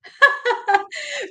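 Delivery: bright burst of laughter, high up; high delighted laugh. Real, not acted. Telling how a fan melted at her voice, a high delighted laugh.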